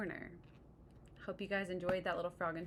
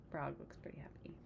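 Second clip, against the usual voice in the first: whispered; 3 semitones lower, 9 dB quieter than usual. Real, not acted. Aside about Frog.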